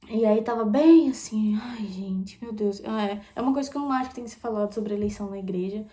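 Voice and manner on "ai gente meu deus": whispered ai gente, meu Deus, half to herself; an unvoiced, exasperated sigh of words. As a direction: breathe it out in disbelief. Exasperated aside at church.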